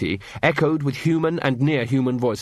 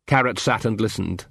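ordinary delivery

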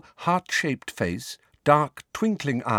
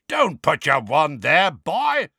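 louder, higher furious roar; 7 semitones higher, 5 dB louder than usual. Acted. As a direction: roar the warning at him. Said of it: "roared Moody".

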